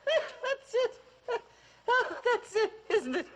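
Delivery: laughing out the words with high-pitched glee; crowing with laughter, thrilled; laughing delight. Real, not acted. Old mother laughs at the actress copying her, high laughing delight.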